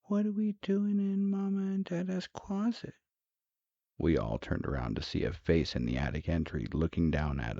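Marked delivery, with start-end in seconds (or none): 0.0-2.9 put-on very high child's voice; put-on small kid falsetto; young son. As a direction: ask it like a puzzled little boy. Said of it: "when I heard my son say".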